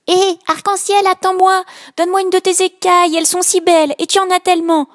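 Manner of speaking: high, loud small-fish voice; a tiny fish pestering for a scale; eager little fish, high and loud; little blue fish. Acted.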